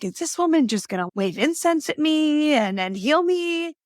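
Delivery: high, incredulous mock disbelief; mock skeptical. Recalling her doubt about a naturopath, high mocking disbelief. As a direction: poke fun at her old doubts, voice climbing high. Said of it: "And I'm like".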